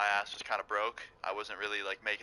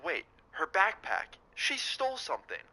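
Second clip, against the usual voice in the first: slow, higher, dawning shock; retold gasp of realization; sudden realization; 7 semitones higher than usual. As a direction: it hits you mid-sentence what she did.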